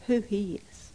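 hushed whisper of awe; breathless reverent whisper; awed whisper. Real, not acted. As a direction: drop to a whisper before God. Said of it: "whispered awe".

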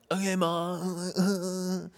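put-on soft and slow little-kid answer; dutiful small child, barely audible; sweet kid reply. Child answering mom at the porch, soft and slow. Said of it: "I was like".

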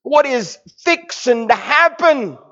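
high, slow, emotional aside; Jesus about to raise Lazarus, overcome with emotion; knowing excitement. Acted. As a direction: hold back the joyful secret. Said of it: "Jesus is saying".

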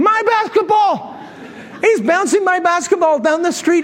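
high-pitched indignant disbelief; comic affronted squawk; mock outrage. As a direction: exclaim it, scandalized. Outraged at seeing his ball.

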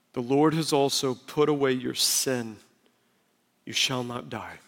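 low, soft reassurance; gentle pardon. Acted. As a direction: grant forgiveness quietly and gravely. Nathan's pardon, conversation softens.

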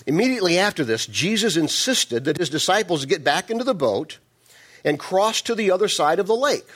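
scripture reading. Acted. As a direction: read the verse out to the congregation. Reads scripture aloud.

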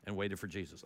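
low, soft, fast muttered told-you-so; grumbling. Retold or imitated. Thomas grumbles in the boat, low muttered told-you-so. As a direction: grumble it under your breath. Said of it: "Thomas or somebody in the boat said".

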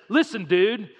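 acting out a heated outburst; retold angry yell. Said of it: "he yells out real loud".

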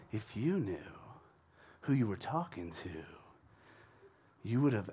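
very soft, low, creepy otherworldly voice; Jesus. Acted. TV-channel Jesus talking like an angel, creepy. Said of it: "it's just creepy. He's just creepy every time".

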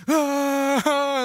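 a high, loud short scream of panic; retold panic scream. Friends in a spinning car look at each other, high panicked scream. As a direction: yelp it out like the car just spun.

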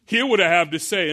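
ordinary delivery

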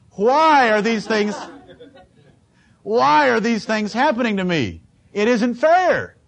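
a hint of a whiny, self-pitying churchgoer; lightly mock the complainer, with a laugh; mock whining. Pastor mimicking his complaining flock, mock whining.

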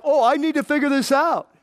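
hammed-up dawning alarm; sudden realization. Churchgoer realizing too late, hammed-up panic.

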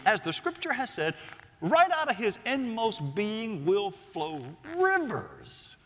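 high, ringing sermon reading; scripture proclamation. Acted. Preacher reads Jesus's invitation to the congregation, proclaiming. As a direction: proclaim the verse, stretching the key words.

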